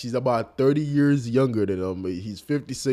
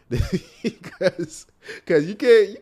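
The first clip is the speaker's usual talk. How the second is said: laughing; 9 semitones higher, 4 dB louder, much slower than usual. Real, not acted. Laughing at his reply.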